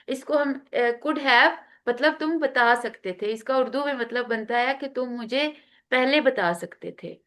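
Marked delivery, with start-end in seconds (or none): none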